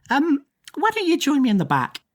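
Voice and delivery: high, sing-song invitation; coaxing shopkeeper. Acted. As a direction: lure her into the back room sweetly. Plant shopkeeper invites her back.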